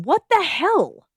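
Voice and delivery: high, loud yell. A narrator's reading. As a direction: shout it in disbelief. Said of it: "I yelled".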